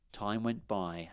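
ordinary delivery

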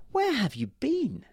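put-on a mother asking her late girl, drawn out. A narrator's reading. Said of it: "said her mother".

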